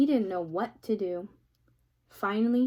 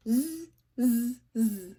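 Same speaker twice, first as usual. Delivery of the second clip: buzzing monkey mimicry; monkey chatter; 4 dB louder, slower than usual. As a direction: tease back like cheeky monkeys. Monkeys mocking the peddler.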